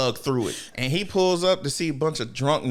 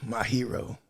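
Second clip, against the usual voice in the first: mock adoration; 4 semitones lower, 6 dB quieter than usual. Fan gazing at a picture.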